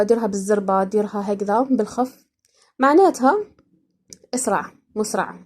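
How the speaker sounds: ordinary talk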